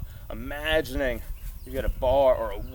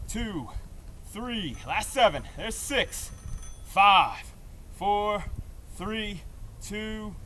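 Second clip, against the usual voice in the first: straining countdown; 6 semitones higher, much slower than usual. Real, not acted. Counting down the hard wall sit.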